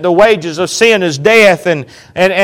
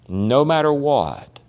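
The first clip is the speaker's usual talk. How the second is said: slow quiet echo; 5 semitones lower, 9 dB quieter than usual. Shown as a demonstration. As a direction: repeat it slower and much softer.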